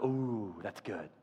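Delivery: low, soft "ooh" of appreciation; retold quiet, pleased reaction; hushed approval. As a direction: murmur it low, quietly impressed.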